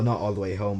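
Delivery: ordinary talk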